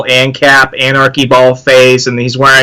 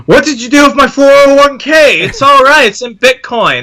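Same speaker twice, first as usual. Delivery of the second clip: put-on high, breezy voice of a crypto kid; high mock voice of a son who lost the 401k; smug crypto son; 11 semitones higher than usual. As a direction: reassure dad like a smug kid. Son voiced defending Bitcoin.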